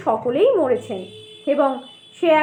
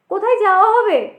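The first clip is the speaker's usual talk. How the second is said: a lightly hollow, quick, louder question; hollow pot voice; 4 semitones higher, 5 dB louder, faster than usual. A narrator's reading. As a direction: ask as if your voice echoes out of a clay pot. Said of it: "মনে হলো যেন হাঁড়ির ভেতর থেকে বেরোচ্ছে".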